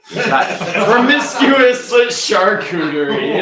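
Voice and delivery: loud, high, slow with a laugh breaking through; laughing punchline. Real, not acted. Player revealing the final answer to teammates, laughing. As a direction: crack up as you land the answer.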